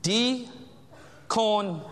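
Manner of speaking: Diakonos stretched out very slowly, syllable by syllable; a preacher slowing Diakonos right down for his listeners. Shown as a demonstration. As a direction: draw out Diakonos slowly so the congregation can catch it. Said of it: "I'm gonna say it slower".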